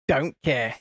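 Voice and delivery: ordinary talk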